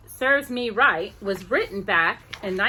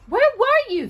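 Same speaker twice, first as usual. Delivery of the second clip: high, loud anxious angel question; worried angels; 7 dB louder than usual. Acted. Angels asking the little angel where she went, high and loud. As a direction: demand it like fretting angels.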